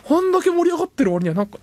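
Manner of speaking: ordinary talk, nothing marked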